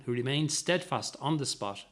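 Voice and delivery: usual speaking voice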